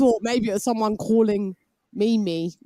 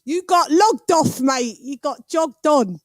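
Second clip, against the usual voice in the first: mock British banter; 5 semitones higher, 3 dB louder, slower than usual. Mocking British slang at viewer.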